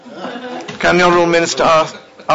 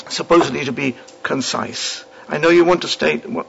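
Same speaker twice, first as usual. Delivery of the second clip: ordinary talk, nothing marked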